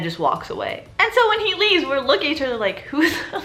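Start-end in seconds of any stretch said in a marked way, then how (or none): 2.9-3.4 laughing disbelief. Retold or imitated. Classmates' disbelief after principal leaves.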